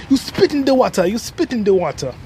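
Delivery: put-on light Jamaican accent, first line high; imitating the Jamaican guy with a hint of accent. Boy retelling what the Jamaican man said, light Jamaican accent. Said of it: "cuz said".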